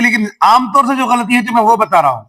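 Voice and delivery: normal talking voice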